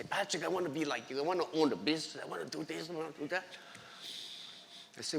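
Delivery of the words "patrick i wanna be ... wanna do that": put-on soft, quick young voices. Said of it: "come to me and say".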